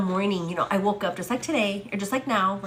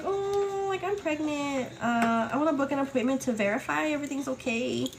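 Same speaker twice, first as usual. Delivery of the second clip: nervous polite caller; 6 semitones higher than usual. Retold or imitated. Phoning the clinic.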